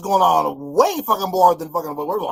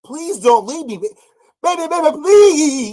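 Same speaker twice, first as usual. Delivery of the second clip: high, louder whiny plea, hammed up; mock begging; 9 semitones higher, 8 dB louder than usual. Reactor mocks the streamer's begging, hammed-up high whine. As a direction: beg like a needy partner, overdoing it. Mocking her begging from clip.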